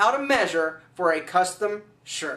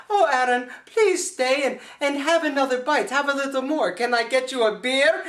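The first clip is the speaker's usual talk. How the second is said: higher-pitched mock Swedish hostess; playful Swedish-accented pleading, pitched up; 4 semitones higher than usual. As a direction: coax him in a put-on Swedish accent. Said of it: "she's got an accent and it's Swedish".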